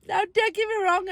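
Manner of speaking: ordinary talk, nothing marked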